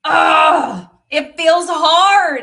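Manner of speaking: loud, high anguished cry; a real, loud, distressed cry. Real, not acted. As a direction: let the frustration break out in a wail. Tapping release, crying out frustration.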